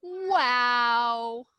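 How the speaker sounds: drawn-out, awed wow; amazed wow. A narrator's reading. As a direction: let the wonder hang in it. Class amazed, read aloud.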